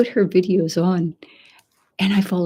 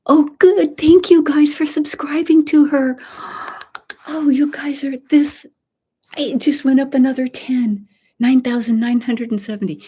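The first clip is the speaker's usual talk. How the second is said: high, loud delighted cheering at the rising count; thrilled, voice jumping up and louder; excited; 8 semitones higher, 5 dB louder than usual. Real, not acted. Streamer watching a friend's subscriber count climb, excited.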